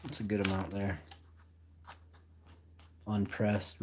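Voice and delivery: ordinary talk, nothing marked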